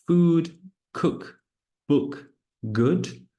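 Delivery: food with a long oo, cook, book, good short; careful word list. Shown as a demonstration. Teacher reading words for students. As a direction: read each word clearly. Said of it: "Listen and circle what is different".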